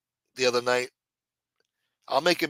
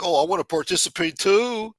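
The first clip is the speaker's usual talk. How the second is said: eager participant; 6 semitones higher, 4 dB louder than usual. Retold or imitated. Voicing eager chat viewers.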